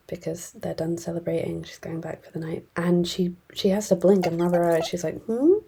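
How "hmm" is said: high questioning hum; a higher, surprised hmm at what she sees; puzzled hmm. Retold or imitated. Girl rubbing her eyes at the wolf girl, puzzled hum.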